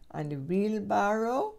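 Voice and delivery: usual speaking voice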